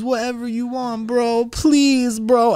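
very high, whimpering mock plea; hammed-up tearful pleading; mock crying. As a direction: imitate a crying coworker begging.

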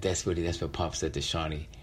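plain reading voice

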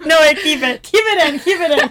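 high, loud plea through real laughter; laughing plea. Real, not acted. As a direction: crack up while insisting. Cracking up, asks not to cut it.

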